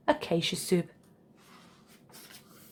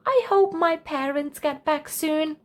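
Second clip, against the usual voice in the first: put-on young Charlie; 7 semitones higher than usual. A narrator's reading. Charlie wistful.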